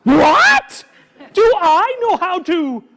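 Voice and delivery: loud, high, indignant disbelief; mock outrage. Performer playing shocked for the kids. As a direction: act hugely offended at the question.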